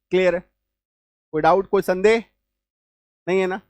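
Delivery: ordinary talk, nothing marked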